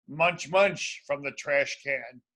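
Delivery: ordinary delivery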